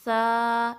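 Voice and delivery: loud steady long aa on سا; two-count stretch. Shown as a demonstration. Teacher demonstrating length, two-count سا with th. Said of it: "ٹو counts لمبا کرنا ہے".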